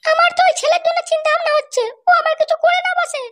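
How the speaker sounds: high put-on voice of a worried village mother. Mother worrying her son will harm himself, high female voice. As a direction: play the anxious mother, pitched well up.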